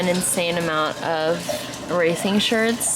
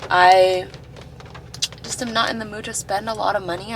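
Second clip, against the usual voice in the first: ordinary talk, nothing marked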